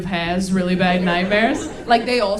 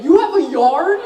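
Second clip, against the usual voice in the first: higher, louder amazed question; 7 semitones higher, 11 dB louder than usual. Retold or imitated. To the Tinder guy, loud amazed laughing question. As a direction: gasp out the question in delighted disbelief.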